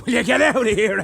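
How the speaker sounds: ordinary talk, nothing marked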